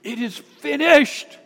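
high, slow, loud proclamation; acted cry of completion. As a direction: cry it out high and loud, stretching the last word. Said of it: "he cried out with a loud voice".

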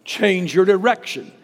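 firm pulpit call to change course; preaching. Acted. Preacher urging the congregation, strong and firm. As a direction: declare it to the congregation. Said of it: "It means change your mind".

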